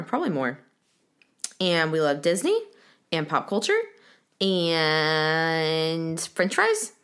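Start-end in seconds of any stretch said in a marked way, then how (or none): 4.4-6.9 stretched, rising drawl; very slow drawn out. Acted. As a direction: linger on the fries. Stretched comic ending.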